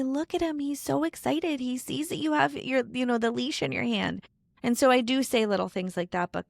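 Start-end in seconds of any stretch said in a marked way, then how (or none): 0.0-4.3 high, encouraging voice about the dog; coaxing the kids. Retold or imitated. Mother urging grumbling kids to walk the dog, high coaxing. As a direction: lift your pitch to cheer them on. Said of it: "I'll say".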